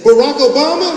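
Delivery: high, loud hopeful question; excited guess. Retold or imitated. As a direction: imitate a thrilled guess, pitch soaring. Wife's excited guess, retold.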